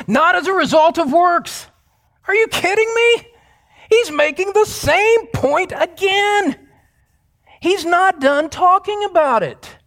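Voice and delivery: very high, incredulous outburst; mock disbelief. Preacher astonished the same point comes again, mock disbelief. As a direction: exclaim in exaggerated amazement, high-pitched throughout.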